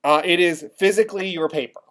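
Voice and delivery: ordinary talk, nothing marked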